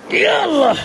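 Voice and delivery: high, drawn-out exclamation; awestruck cry. Real, not acted. Beekeeper opening the comb before viewers, awestruck. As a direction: let wonder stretch the words upward.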